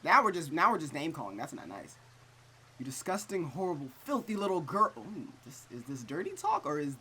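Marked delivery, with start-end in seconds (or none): 0.0-1.4 loud exasperation. Real, not acted. Reacts loudly to insults. 2.8-4.9 cruel villager. Acted.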